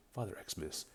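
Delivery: normal talking voice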